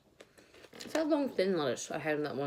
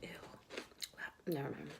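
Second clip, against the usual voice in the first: ordinary talk, nothing marked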